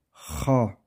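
usual speaking voice

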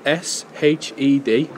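slow spelling, letter by letter; dictating. Acted. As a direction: spell each letter out clearly. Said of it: "I'll spell it out to you".

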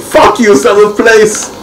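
high, slow, punched-out curse; real gamer rage; angry shout. Real, not acted. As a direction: yell it bitterly at the result. Dropped to seventh place.

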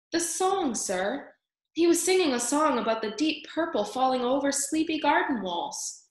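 high-pitched, rapt little mouse; guileless, dreamy Despereaux; earnest young mouse. A narrator's reading. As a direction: explain the wonder to a stern elder.

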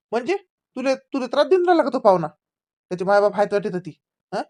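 loud, rushed shouting into the phone. Acted. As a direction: yell over a bad line, fast and loud.

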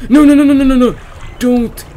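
real alarm, fast and loud; panicked no. Real, not acted. Gamer panics as the monster appears, loud and high.

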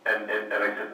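normal talking voice

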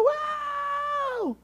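high, drawn-out "wow" of a cheering crowd; crowd holler. Retold or imitated. Preacher imitates an underdog's crowd at the final score, high holler. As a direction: holler it like a fan leaping up. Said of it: "they jump up to their feet and they holler".

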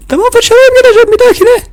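high, loud toy promise; a scolded little one giving in; shrill puppet reply; squeaky child voice. Acted.

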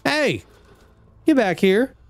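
high yell after an enemy; calling out. Real, not acted. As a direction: shout it up high, chasing. Chasing fleeing enemy.

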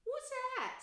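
high, startled question; sudden surprise. Acted. Twin spotting Harry's scar, high and startled. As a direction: blurt it out. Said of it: "said one of the twins suddenly".

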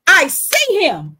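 high, slow mock shout of I see him; retold shouting. Homeless man crying out at her, retold as a high slow mock shout. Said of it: "because he was screaming it".

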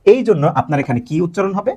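ordinary delivery

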